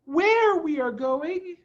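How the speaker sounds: put-on high, eager child asking; a young fox pleading to know; lightly voiced small child; child's voice. A narrator's reading. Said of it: "said one of the children".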